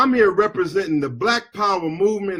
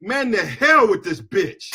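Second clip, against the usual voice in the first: ordinary talk, nothing marked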